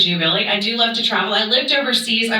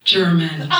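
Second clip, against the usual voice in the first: ordinary talk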